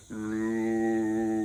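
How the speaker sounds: deepest, lowest sung note; extremely deep bass voice. Shown as a demonstration. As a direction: sing it as low as you possibly can. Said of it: "you're gonna sing very low".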